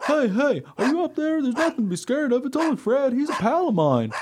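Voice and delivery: high, loud, eager dog voice; friendly dog character. Acted. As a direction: call up cheerfully as a very high-pitched dog. Dog calls up the tree.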